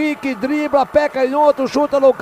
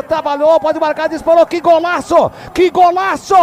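higher, loud goal scream; explosive goal commentary; 6 semitones higher, 6 dB louder than usual. Real, not acted. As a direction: erupt with the goal, hold gol long. Narrator calls Grêmio goal.

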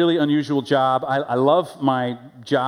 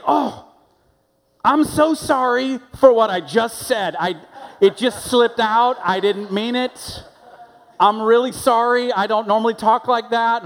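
flustered apologizer; 7 semitones higher than usual. Retold or imitated. Stranger apologizing to the pastor for swearing, high and gushing. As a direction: imitate someone mortified after swearing in front of the pastor. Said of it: "They'll say".

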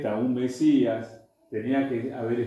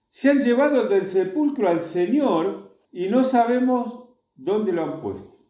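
a hint of her alarm, pitched up and pressed; Mary Magdalene, alarmed; 9 semitones higher, 4 dB louder than usual. Mary Magdalene tells the disciples the body is gone, lightly alarmed. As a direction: voice her breathless worry at the empty tomb.

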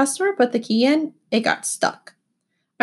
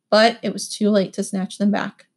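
ordinary delivery, nothing marked

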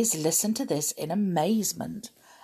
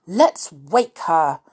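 slow, louder, understated fierceness; fierce; 6 dB louder, slower than usual. A narrator's reading. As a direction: urge it firmly and slowly. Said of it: "said Tom fiercely".

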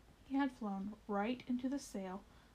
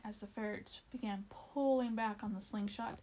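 plain reading voice

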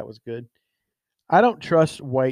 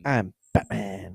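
slow, low, softer hero voice; 6 semitones lower, 6 dB quieter, slower than usual. Acted. Co-host topping the superhero line, slow low voice. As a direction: sink it low and draw it out. Answers with lower Batman take.

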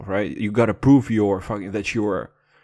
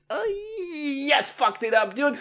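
high mock sobbing over a flubbed line; fake-wail about messing it up; mock crying; slower than usual. Podcaster flubs his read, fake sobbing.

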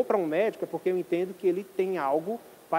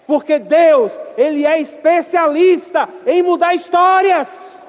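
fiery preaching; 4 semitones higher, 8 dB louder than usual. Acted. Preaching at full force.

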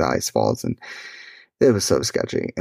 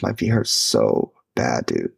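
usual speaking voice

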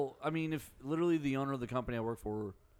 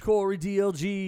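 loud, higher hype name call; hype announcer; 6 semitones higher, 9 dB louder than usual. Acted. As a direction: shout it like a ring announcer. Host announces own name.

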